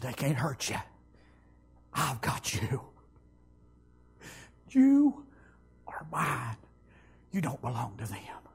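low whisper, very soft; whispered words of Jesus to a frightened man; whispered divine voice. Retold or imitated. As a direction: breathe it like a gentle command. Voicing the Lord's still voice.

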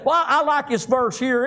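normal talking voice

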